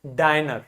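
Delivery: "डाइनर" with a long i, like "eye"; long vowel. Shown as a demonstration. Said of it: "I is pronounced as a long vowel".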